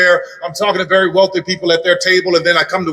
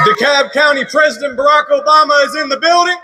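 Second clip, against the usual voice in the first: big event announcer introducing the stars; arena announcer; 8 semitones higher than usual. Acted. Announcer hyping the rally crowd.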